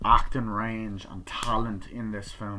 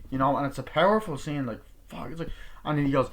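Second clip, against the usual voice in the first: usual speaking voice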